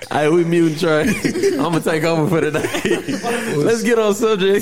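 laughing, talking through giggles; loud and giggly, taking over. Real, not acted. Host laughing while muting co-host.

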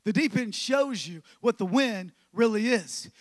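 ringing pulpit declaration; preached out. Acted. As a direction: proclaim it to the whole room. Repeated louder, preached.

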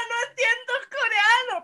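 high, loud protest; retold shouting back. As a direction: shout back that you're lost. Acted reply shouted back at yeller.

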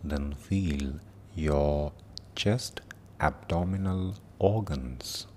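slow meditation voice with long pauses; guided meditation. Acted. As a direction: guide them gently through the body, unhurried.

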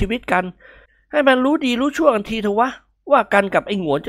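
swaggering daydream of victory; boastful young man. A narrator's reading.

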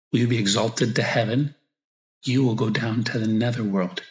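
ordinary talk

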